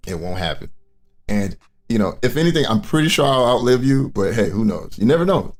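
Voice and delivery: ordinary talk, nothing marked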